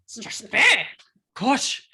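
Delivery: put-on a playful Scottish-accented Strasbourg. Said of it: "I'm getting my accent".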